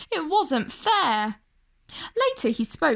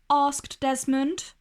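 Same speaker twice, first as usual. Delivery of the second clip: ordinary delivery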